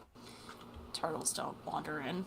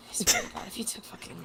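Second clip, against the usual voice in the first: whisper; 3 semitones higher, faster than usual. Real, not acted. Threatening friend over stolen items.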